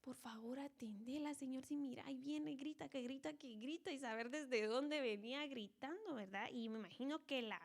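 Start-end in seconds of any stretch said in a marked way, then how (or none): 0.0-3.9 soft, hushed urgent begging; whisper-close pleading with the Lord; softer, pressing request; disciples' plea. Retold or imitated. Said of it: "va así como".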